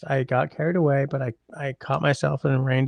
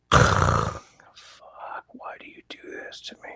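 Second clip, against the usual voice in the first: exasperated breathy whisper under breath; 3 dB quieter, slower than usual. Real, not acted. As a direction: whisper it in despair. Exasperated at chat jumping back.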